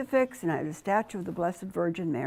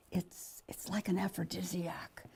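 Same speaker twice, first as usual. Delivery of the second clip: hushed; 11 dB quieter than usual. Real, not acted. Confiding about the stage.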